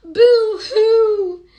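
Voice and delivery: high, loud hammed-up boo-hoo wail; mock crying. Little girls wailing to Miss Clavel, mock sobbing. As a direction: wail like jealous little girls, half laughing. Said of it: "all the little girls cried".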